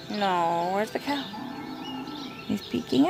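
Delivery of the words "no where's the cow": usual speaking voice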